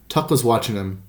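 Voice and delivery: usual speaking voice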